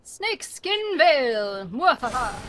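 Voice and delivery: slow dramatic villain reveal, cackling; mad scientist. Pretend. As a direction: announce it like a gleeful mad villain. Playing the card dramatically.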